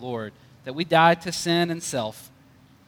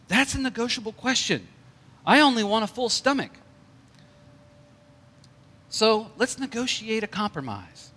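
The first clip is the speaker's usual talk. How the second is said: higher, coaxing bear voice, softly persuasive; soothing bear voice; 6 semitones higher than usual. Acted. Bear coaxing the hunter not to shoot, high and soothing. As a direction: talk the hunter down gently, as a sly bear. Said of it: "spoke softly in a soothing voice".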